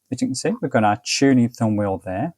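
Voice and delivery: normal talking voice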